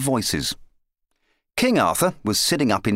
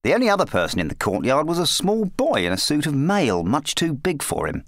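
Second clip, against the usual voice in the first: plain reading voice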